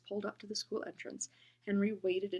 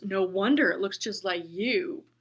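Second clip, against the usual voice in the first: slow mocking insult; 9 dB louder than usual. Imagined bully insult.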